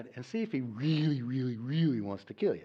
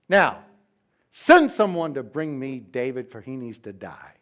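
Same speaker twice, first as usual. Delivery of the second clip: commanding king; 7 dB louder than usual. Acted. Saul ordering David killed.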